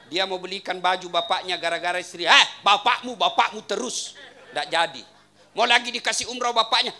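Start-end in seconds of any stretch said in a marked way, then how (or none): 2.2-4.2 loud whiny wife complaint; nagging wife. Retold or imitated. Wife grumbles to husband about his father, loud and whiny. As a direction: whine it like a fed-up wife.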